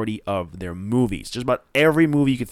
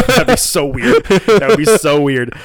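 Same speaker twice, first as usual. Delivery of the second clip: laughing; 7 semitones higher, 10 dB louder than usual. Real, not acted. Laughing at the mix-up.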